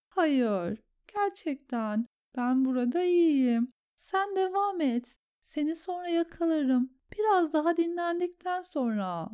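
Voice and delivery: high, faint reassuring plea; exhausted Sam. A narrator's reading. (tiz, bitkin, yumuşak yakarış)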